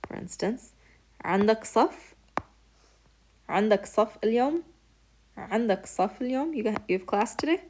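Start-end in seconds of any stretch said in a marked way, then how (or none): 1.2-2.1 عندك صف؟ asked with a rise up on صف; question rise. Shown as a demonstration. Teacher to students, asking a question by rising pitch alone. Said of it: "Just ask it with inflection". 3.5-6.5 عندك صف اليوم؟ asked higher and quick, rising on اليوم; question rise. Shown as a demonstration. Teacher to students, quick questioning lift on اليوم. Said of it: "Just ask it with inflection".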